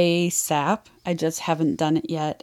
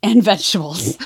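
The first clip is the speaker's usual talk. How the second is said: laughing; 5 semitones higher, 5 dB louder than usual. Real, not acted. Reading funny trigger warnings.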